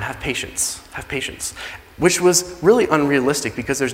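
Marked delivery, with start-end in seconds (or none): 0.0-0.8 softly begging, voice sinking low; hushed plea. Acted. Servant begs the king. 0.9-1.5 breathy whispered begging; whispered plea. Acted. Servant begs the king.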